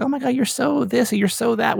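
high, mock gushing greeting; gushing fans. People greeting him, high mock gushing voice. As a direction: imitate people fawning over you, pitched high. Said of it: "people are like".

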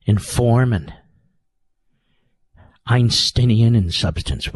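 ordinary talk, nothing marked

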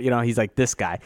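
ordinary talk

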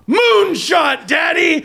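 put-on high shouted kid voice; excited little son. Son celebrating a high hit to Daddy, thrilled. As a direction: cry it out high with delight.

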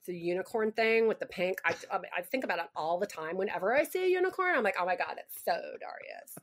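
put-on gushing Valley Girl chatter with swoops in pitch. Shown as a demonstration. Actress gushes to Darius about unicorns, Valley Girl accent. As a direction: do a California Valley Girl, trailing into softness. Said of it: "I can do Valley Girl really well".

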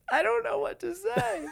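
very high squeaky retelling of being stumped; comic falsetto, at a loss for words; mock helpless reply. Acting out his own stunned reply.

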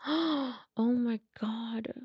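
a hushed, stunned whisper, drawn out; slow awed whisper. Retold or imitated. Reliving seeing two butterflies, in a slow awed whisper. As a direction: breathe it out slowly, barely voiced.

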